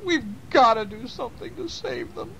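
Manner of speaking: high, hammed-up sobbing voice; mock-weeping mother raccoon; crying hard. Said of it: "was crying so hard she could barely talk".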